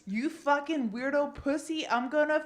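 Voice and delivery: acted loud threat; aggressive bro. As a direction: hurl insults like a bro picking a fight. Acting angry bro coming back.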